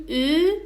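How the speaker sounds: lips rounded, long ü vowel; rounded ü sound. Shown as a demonstration.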